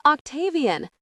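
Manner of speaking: high-pitched, understated scream of a name. A narrator's reading. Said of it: "Ravi screams".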